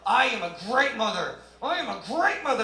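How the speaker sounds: mock outraged mother imitation; pissed-off mother. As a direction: insist like an offended mom. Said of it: "She came in all pissed off".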